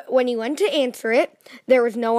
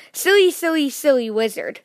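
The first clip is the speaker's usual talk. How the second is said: groaning complaint. A narrator's reading. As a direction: grumble it while picking yourself up. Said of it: "groaned Mister Mischief".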